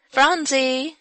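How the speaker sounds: high, loud cheerful call; merry call. A narrator's reading. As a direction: call out to the little sister. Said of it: "she called with a merry little laugh".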